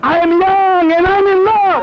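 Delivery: high, loud, slow proclamation; exultant shout. Real, not acted. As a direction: cry it out to the crowd, stretched. Preacher exults to congregation.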